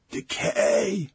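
strained, unvoiced croak past a blocked throat; throat-choked voice. A narrator's reading. Ailing test subject speaking up from the chair, choked croak. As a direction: choke the word out. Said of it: "sounded as if there were something stuck in his throat".

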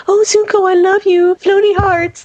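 high, sing-song mock swooning over Zuko; mock lovestruck gushing. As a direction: gush like a smitten schoolgirl, hammed-up. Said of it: "she needs to be like".

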